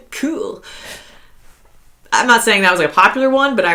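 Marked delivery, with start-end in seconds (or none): 0.1-0.6 "Cool" as a high, soft "kewl". Retold or imitated. Repeating the college slang for a laugh, high "kewl" for "Cool". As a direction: lift and front the "oo" of "Cool" into "ew". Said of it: "K E W L".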